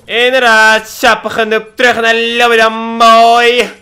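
loud gloating taunt, dragged out; loud, slow mocking gloat. Real, not acted. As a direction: rub the loss in his face. Taunting a defeated opponent.

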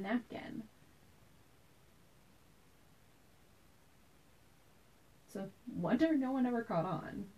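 soft, measured storytelling with reflective pauses; dramatic monologue. Acted. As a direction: recount a childhood memory as a quiet stage monologue. Performs a memory monologue.

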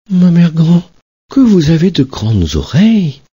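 put-on high, amazed little girl; wondering girl. Little girl in bed marvelling at grandmother, high. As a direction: marvel at her arms.

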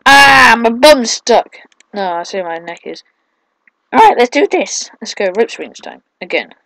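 normal talking voice

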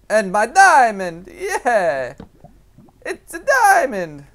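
high, loud, stretched cheer; jubilant shout. Real, not acted. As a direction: celebrate a big find at full volume. Celebrates finding a diamond.

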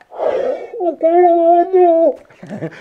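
very high, very slow, muffled reply; drawn-out answer from far off underwater; hammed-up buddy calling back through a mouthpiece. Shown as a demonstration.

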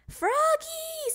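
long high call of his name; mother calling out. A narrator's reading. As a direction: call him from across the house. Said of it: "said his mother".

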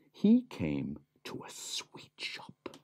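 slow whispered suspense. A narrator's reading. Narrator whispers to Cecil, slow. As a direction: hush it, building suspense.

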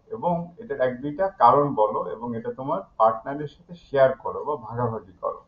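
ordinary delivery

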